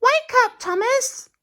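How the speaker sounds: very high, bright call to wake him; Dilly the barge. Acted. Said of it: "Dilly called".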